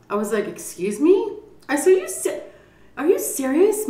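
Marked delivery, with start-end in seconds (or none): 0.5-1.9 high, rising disbelief; mock incredulous. Retold disbelief at niece's offer. 1.9-3.9 very high, rising astonished question; mock incredulous. Retold disbelief at niece's offer.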